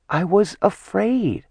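small, fearful voice owning up; a goddess shrinking as she confesses; frightened confession. Acted. Artemis voiced by narrator.

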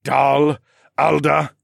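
loud, very slow scoffing; snorted name. A narrator's reading. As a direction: sneer the name with contempt. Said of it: "he snorted".

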